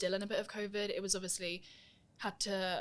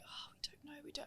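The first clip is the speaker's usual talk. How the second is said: hushed unsure reply; 7 semitones higher, 16 dB quieter than usual. Retold or imitated. Staff hesitant about filming request.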